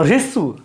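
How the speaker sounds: रिस्व said as rissu, ri first; रिस्व with a clear ri sound; pronouncing rissv. Shown as a demonstration. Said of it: "ये ह्रस्व नहीं है". (रिस्व का उच्चारण रिसु)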